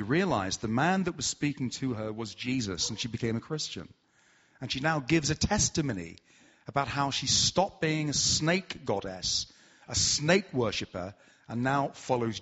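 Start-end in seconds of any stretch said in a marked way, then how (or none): none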